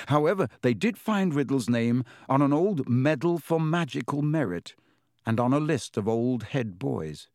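ordinary delivery, nothing marked

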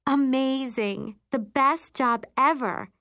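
high, drawn-out "amazing" gush. A narrator's reading. Friend raving about her hospital job, gushing. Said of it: "she gushes".

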